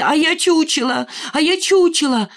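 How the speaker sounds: high, defiant narrated cry. A narrator's reading. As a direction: cry it out twice, high and taunting. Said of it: "кричала ей". (кричит с вызовом)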